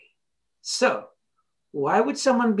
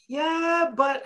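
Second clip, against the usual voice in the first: drawn-out, higher mock "yeah, but"; reluctant objection; 9 semitones higher than usual. Kid resisting being told to decide, mock reluctant. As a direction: imitate someone resisting advice.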